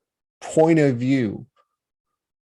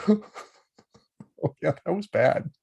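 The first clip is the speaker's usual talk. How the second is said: giggling through the words, pitched way up; laughing it off; 4 semitones higher, 4 dB quieter, much slower than usual. Shown as a demonstration. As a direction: laugh it off fondly. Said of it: "I laugh about it".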